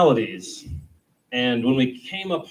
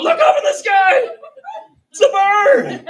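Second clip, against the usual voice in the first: very high, loud, laughing yell; grabbing strangers to point at a bird; hammed-up laughing shout; mock excited yell; 11 dB louder than usual. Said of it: "grabbing other strangers on the street and going".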